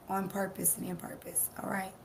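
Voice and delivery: whisper. Real, not acted. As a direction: drop to a soft whisper. Sudden drop in intense talk.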